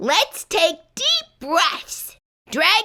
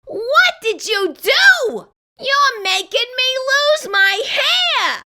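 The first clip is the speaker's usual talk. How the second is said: higher, slow distressed complaint; dismayed outburst; 6 semitones higher, slower than usual. Acted. Character blaming the kid for his hair loss, dismayed. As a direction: wail about losing hair.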